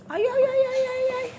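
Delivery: high, soft mock squeal; rapid little high-pitched cries; playful squealing. Squealing along during child's ride.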